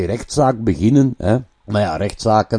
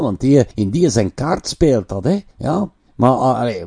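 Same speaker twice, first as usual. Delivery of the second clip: ordinary talk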